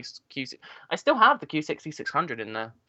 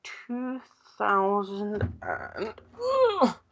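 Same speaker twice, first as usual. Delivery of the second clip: straining; 9 semitones higher, much slower than usual. Real, not acted. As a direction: push the words out while lifting. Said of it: "You all right?".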